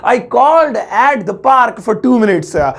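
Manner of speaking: slow, deliberate teacher model sentence; slow careful English. Shown as a demonstration. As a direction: lay out each word slowly for the class. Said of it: "तो English होगी".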